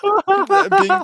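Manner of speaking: high, laughing through the words; helpless laughter breaking into the line; cracking up. Real, not acted. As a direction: fight through real giggles while reading on. Cracking up during a script read.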